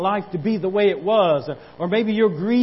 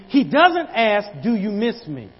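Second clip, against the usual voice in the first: higher, louder preacher's cry, slowed at first; full-force preaching; 6 semitones higher, 8 dB louder than usual. Acted. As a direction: preach it out, building.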